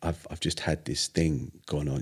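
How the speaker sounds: mildly dazed, quiet stammer; retold halting speech. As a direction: falter as if words won't come. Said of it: "I couldn't speak properly".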